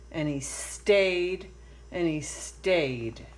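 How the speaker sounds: ordinary delivery, nothing marked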